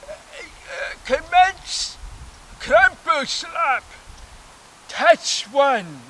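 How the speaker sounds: high, loud, slow ringside call; announcer bird. Retold or imitated. The bird on the pillar opens the match with a high announcer call. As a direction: announce the bout like a pompous referee.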